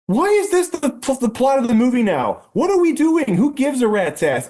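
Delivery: high-pitched, incredulous mock outburst; exasperated rant. Viewer re-enacting frustration with the plot, exasperated. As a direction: demand answers, voice pushed way up.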